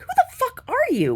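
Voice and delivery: high, slow offended snap; indignant retort. Retold or imitated. Snapping back at a nosy man asking about her father. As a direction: hit back at the nosy question. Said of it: "I'm like".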